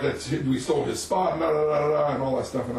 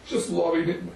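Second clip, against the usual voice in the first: usual speaking voice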